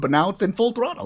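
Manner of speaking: normal talking voice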